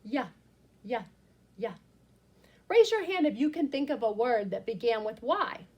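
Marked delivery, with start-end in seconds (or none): 0.0-1.8 "Yeah" as the Y sound, clipped short; letter sound. Shown as a demonstration. Teacher modelling the Y sound for the class. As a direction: tap out "yeah" three times. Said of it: "Y says".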